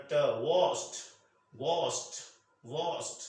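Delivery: washed said as one syllable, ending in a t sound; correct pronunciation. Shown as a demonstration. Teacher modelling the correct one-syllable washed with a final t. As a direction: end washed crisply on t, no d. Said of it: "ਟ ਵਰਗੀ sound ਹੈ".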